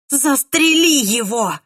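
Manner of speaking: rasped out, hoarse. A narrator's reading. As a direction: croak it out hoarsely. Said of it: "прохрипела мисс".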